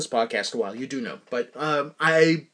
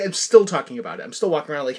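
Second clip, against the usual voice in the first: usual speaking voice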